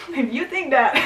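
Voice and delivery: loud, laughing through the words; amused laughing. Real, not acted. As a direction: break up laughing as you say it. Laughs at the idea.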